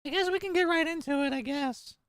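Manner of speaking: put-on squeaky, overexcited little kid; annoying anime kid voice. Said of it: "This voice the whole time?".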